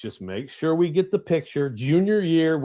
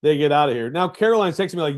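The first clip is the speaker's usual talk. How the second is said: normal talking voice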